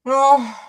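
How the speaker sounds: a sudden high, loud "oh" of exasperation; exasperated cry. Retold or imitated. Wife fed up with husband circling the lot, sharp high exasperated "oh". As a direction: throw up your hands with a sharp high "oh".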